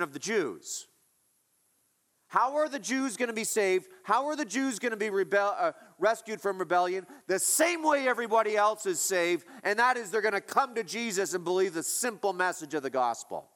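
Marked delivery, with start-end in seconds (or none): 2.3-13.5 high and loud, driving the point home; preaching at full force. Acted. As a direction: preach it hard to the congregation.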